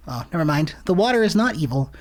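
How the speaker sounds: ordinary delivery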